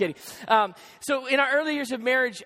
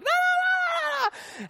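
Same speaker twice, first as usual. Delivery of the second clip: high, drawn-out gibberish wail; exaggerated slow wail, pitched way up; mock hysterical wailing; 3 dB louder, slower than usual. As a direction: imitate a screaming, crying phone rant without words. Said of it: "hysterically crying, yelling at me".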